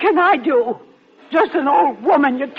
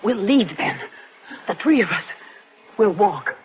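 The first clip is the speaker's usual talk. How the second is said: normal talking voice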